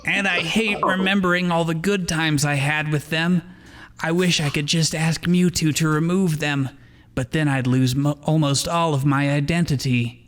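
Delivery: worried inner voice, gently played; lightly voiced Ash. Ash's inner voice continues.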